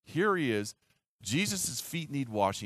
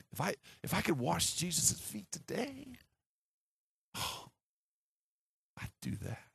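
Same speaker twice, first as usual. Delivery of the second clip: wistful longing; 5 semitones higher, 7 dB quieter, slower than usual. Real, not acted. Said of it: "longing to wash Jesus' feet".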